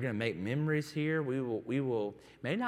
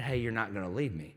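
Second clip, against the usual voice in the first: usual speaking voice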